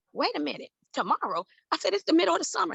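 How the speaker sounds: high, disbelieving wait a minute; can't believe it starts tomorrow; shocked protest. Retold or imitated. To the college man, high disbelieving protest.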